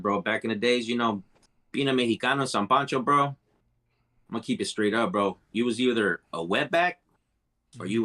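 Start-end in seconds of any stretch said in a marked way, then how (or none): none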